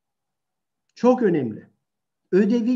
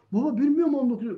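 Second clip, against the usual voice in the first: put-on child's whine; 7 semitones higher, faster than usual. Child voiced.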